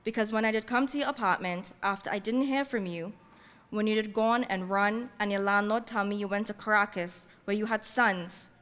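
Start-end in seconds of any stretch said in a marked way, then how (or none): none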